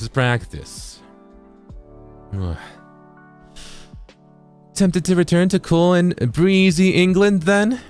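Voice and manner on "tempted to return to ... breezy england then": high-pitched attempt at a British accent; rusty British accent, playfully put on. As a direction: try on a lilting British accent. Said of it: "Do I have to do a British accent?".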